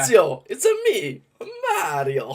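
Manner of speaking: high, loud Italian Mario voice; cheesy Italian plumber accent; Mario impression. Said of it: "the most cheesiest line ever".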